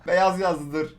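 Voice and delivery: ordinary delivery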